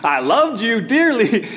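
laughing. Retold or imitated. Wife's joking confession, laughs heard.

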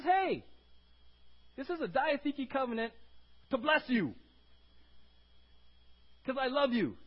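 high, loud preaching as God; impassioned divine appeal. Acted. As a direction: call out earnestly to the people. Preacher voices God calling out.

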